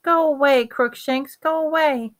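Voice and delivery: high, anxious shooing; moaning plea. A narrator's reading. Hermione to her cat creeping nearer, anxious moaning plea. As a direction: beg the animal to back off. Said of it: "Hermione moaned".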